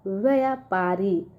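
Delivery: व्यापारी read slowly, each syllable clear; teacher reading a word for children. Shown as a demonstration. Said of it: "क्या लिखा है".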